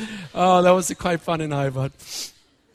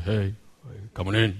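1 lowered father voice; 11 semitones lower, 6 dB quieter than usual. Retold or imitated. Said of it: "fathers learn how to lower the voice".

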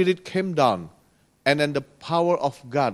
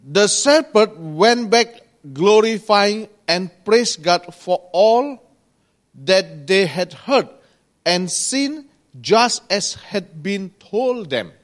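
scripture reading; 4 semitones higher than usual. Acted. Preacher reading Luke to the congregation, projected scripture reading. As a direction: read the verse out to the congregation. Reads the verse aloud.

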